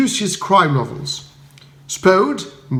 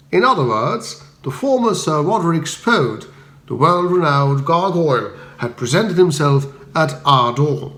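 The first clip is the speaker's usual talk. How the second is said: plain reading voice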